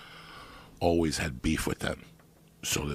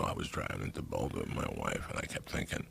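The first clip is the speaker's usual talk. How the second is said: low, hushed mock retelling of the friend's words; lightly voiced friend, dropped low and quiet, slowing at the end; imitating his friend; 13 semitones lower, 7 dB quieter than usual. Retelling his friend's Boulder remark, in a low hushed mock voice. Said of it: "You go".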